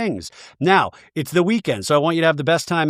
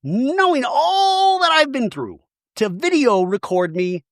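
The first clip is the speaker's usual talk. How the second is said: high, slow mock-indignant reproach; impression of Shannon; 10 semitones higher, slower than usual. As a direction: play the outraged housewife, drawn out high. Acting out Shannon's angry reproach.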